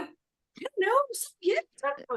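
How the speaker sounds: very high giddy shrugging; giddy unsure. Retold or imitated. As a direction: float it out high and dazed. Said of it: "I go".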